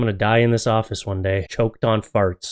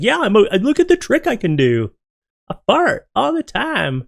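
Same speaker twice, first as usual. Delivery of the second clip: proud dog voice; 9 semitones higher than usual. Pretend. Voicing the dog's boast.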